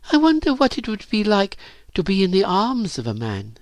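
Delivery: put-on high, musing girl's voice; wistful wondering. A narrator's reading. Virginia imagines a man's arms, wistful. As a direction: wonder aloud, high-pitched and dreamy.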